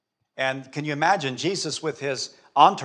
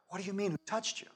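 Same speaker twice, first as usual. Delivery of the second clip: high, much softer repeat; hushed disbelief; 7 semitones higher, 11 dB quieter, faster than usual. Acted. Disciples questioning Jesus about who touched him, hushed disbelief. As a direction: drop the volume, keep the incredulous lift.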